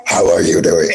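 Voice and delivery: very low, put-on dopey voice; mock greeting. Greeting his bro in a deep goofy voice. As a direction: drop way down into a dumb-guy voice. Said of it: "something along the lines of".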